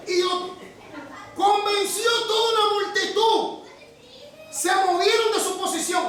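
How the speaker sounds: full-throated pulpit fervor with a laugh; preaching at full force. Acted. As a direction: cry it out to the congregation. Preacher climaxes on the rebellion.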